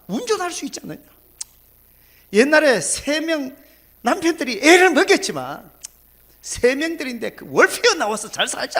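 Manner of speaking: put-on loud, high, eager persuading of an old mother; coaxing reassurance. Pastor urging the sad old woman to count her blessings, loud and eager. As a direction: cheer her up, listing her blessings with gusto.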